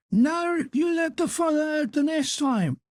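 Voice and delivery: high savage taunt as he strikes; vicious. Acted. Father beats his son with the stick, high vicious taunt. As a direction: lash him with the words. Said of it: "striking at him viciously with the stick".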